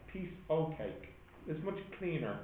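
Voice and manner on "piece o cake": "o'" said as "oh" with no f; dropped f in o'. Shown as a demonstration. Teacher offering the cleaner emphatic form to the class. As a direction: clean "Piece o' cake" with "o'" for "of". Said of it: "emphasize it by dropping the f".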